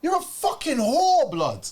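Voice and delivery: put-on ranting at the girlfriend who cheated; furious insult. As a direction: spit it out in outrage. Acted rant at cheating girlfriend.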